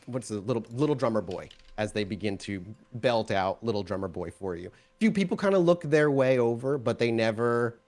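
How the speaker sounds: ordinary talk, nothing marked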